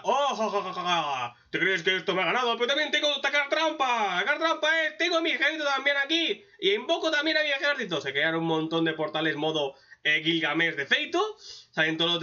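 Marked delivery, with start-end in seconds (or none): 0.0-1.3 theatrical "oh-ho-ho" cackle; put-on gloating laugh; mock villain laugh. Recapper plays the monarch springing his trap, mock villain laughter. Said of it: "El tío está". 1.5-8.0 hammed-up boast, climbing higher; mock triumphant taunt, rising in pitch; gloating villain. Recapper plays the monarch revealing his army, hammed-up gloating. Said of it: "El tío está".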